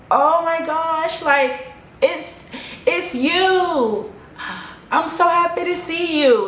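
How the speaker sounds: high, drawn-out gushing greeting; happy and warm. Retold or imitated. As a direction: gush as if seeing old friends after years. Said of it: "I was really just happy and just warm".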